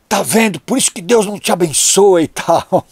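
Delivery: voz mais alta e aguda, acusando; bronca de mãe. Retold or imitated. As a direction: imitando mãe indignada. Mãe brava acusa o filho. (sermão encenado de mãe brava)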